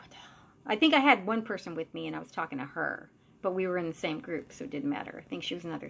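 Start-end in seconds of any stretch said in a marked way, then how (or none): 0.0-0.3 barely audible, stunned whisper; whispered disbelief. Retold or imitated. Medium aside after creature takes off, whispered disbelief. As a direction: whisper it under your breath. Recounts muttered disbelief to companion.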